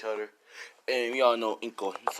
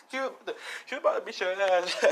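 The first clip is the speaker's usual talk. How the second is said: high voice breaking into laughter; acted giggly disbelief, laughing through the words; laughing his ass off; 7 semitones higher than usual. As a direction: tease a friend while cracking up. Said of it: "Gary just laughing his ass off".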